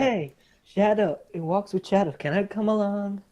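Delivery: lightly voiced eager newcomer; new character voice. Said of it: "You're talking to my new character".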